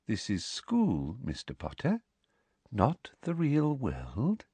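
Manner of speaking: slow, hushed, sweet correction; soft. A narrator's reading. Professor correcting Harry about the real world, softly. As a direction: say it softly, drawing the words out. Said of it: "she said softly".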